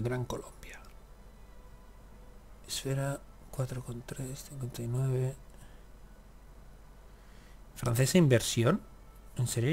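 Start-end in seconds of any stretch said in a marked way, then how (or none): 0.0-5.4 slow whispered aside. Real, not acted. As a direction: breathe the name out slowly. Reading map quietly. (susurro lento)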